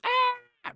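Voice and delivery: very high-pitched "ah" scream; short mock scream. Shown as a demonstration. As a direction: demonstrate an all-out shriek, briefly. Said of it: "Not like".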